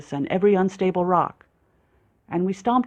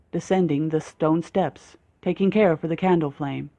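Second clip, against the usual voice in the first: usual speaking voice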